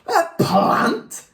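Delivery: high, shocked squawk of disbelief; horrified yell. A narrator's reading. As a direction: yell it way up high as an appalled mother. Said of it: "yelled Mum in horror".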